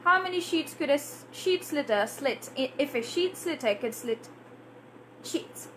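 quick, barely opening the mouth; fluent closed-mouth version. Shown as a demonstration. Fluent speaker saying the tongue twister quickly, lips barely open. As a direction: rattle it off with a relaxed mouth. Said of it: "they can even say".

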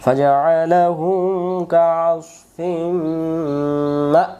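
very slow, drawn-out tajweed chant; slow melodic Quranic recitation. Shown as a demonstration. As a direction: recite the verse, stretching every vowel. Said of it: "সূরাতুল ফিলের শেষের আয়াতে আছে".

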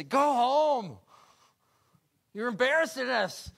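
loud, high scolding shout; scolding brothers. Acted. Older brothers shouting David home, scolding. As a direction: brush the kid off hard.

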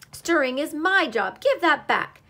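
high, loud squirrel demand; indignant protest. A narrator's reading. Squirrel demanding his spoon back, indignant protest.

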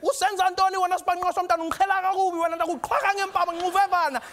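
high, loud mock tirade; scolding Xhosa mother. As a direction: imitate a mother laying into a naughty child. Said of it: "find me doing something naughty".

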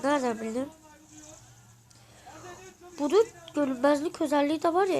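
usual speaking voice

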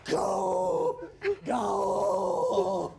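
slow drawn-out lion roar; roaring booming lion. Pretend. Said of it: "ライオンになりますよ".

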